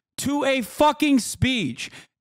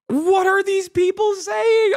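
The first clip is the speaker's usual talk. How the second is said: exasperated shout; 9 semitones higher than usual. Real, not acted. Streamer yelling at undecided voters in real exasperation. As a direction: yell it out, fed up with them.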